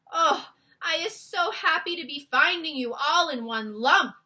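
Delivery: relieved joy. Acted. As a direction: gush with relief. BFG relieved finding Sophie.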